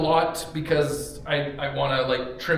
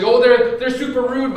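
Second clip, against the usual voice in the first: mock outrage; 8 semitones higher, 10 dB louder than usual. Mocking judgment, loud.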